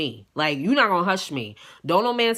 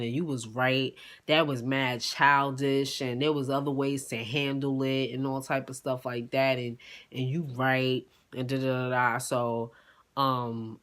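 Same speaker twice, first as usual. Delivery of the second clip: meek apology; 7 semitones lower, 6 dB quieter than usual. Retold or imitated. Eric calling to apologize, soft and sheepish. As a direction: apologize quietly like a scolded kid. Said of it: "He's like".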